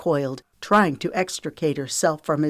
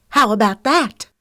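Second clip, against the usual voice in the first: high, loud insistence; outraged challenge; 7 semitones higher, 7 dB louder than usual. A narrator's reading. Lexi points to the mess, outraged challenge. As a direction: throw the evidence at them.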